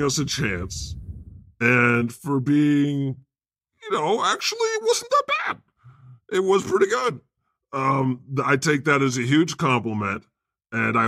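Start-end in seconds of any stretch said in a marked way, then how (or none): 3.8-7.2 squeaky high mock voice, much higher; a squeaky put-on voice; mock high verdict. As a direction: pipe it up high like a fan. Mock reading a fan's verdict.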